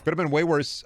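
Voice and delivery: ordinary talk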